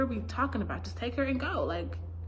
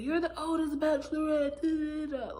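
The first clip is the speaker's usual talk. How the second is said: taunting singsong; 7 semitones higher than usual. Retold or imitated. Mocking Dale's parting insult.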